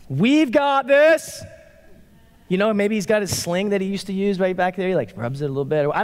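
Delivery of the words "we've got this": high, loud, drawn-out rallying boast; super confident. Acted. David facing an army, acting super confident. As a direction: belt it out with hammed-up confidence. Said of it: "like, super confident".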